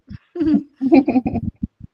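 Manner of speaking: real light chuckle; laugh. Real, not acted. Host after saying everyone will have to laugh, chuckling. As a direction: chuckle at your own remark. Joke that everyone must keep smiling.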